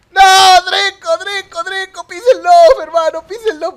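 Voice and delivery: very high, loud, repeated yells; panicked shouting. Real, not acted. Player yelling at Draco in a panic, high frantic shout. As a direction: scream urgent orders.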